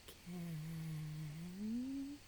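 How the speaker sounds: each word with long pauses between; reading very slowly. Shown as a demonstration. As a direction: drag it out as slow as a snail. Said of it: "I'm reading it slowly because that's what it says".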